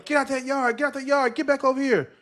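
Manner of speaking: high-pitched imitation of a yelling mother; mama yelling. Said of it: "their mama yelling".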